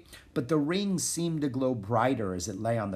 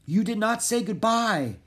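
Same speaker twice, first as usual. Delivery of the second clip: breathless, halting words; panting between words; out of breath; 11 semitones higher, 4 dB louder than usual. A narrator's reading. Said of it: "The prince was out of breath".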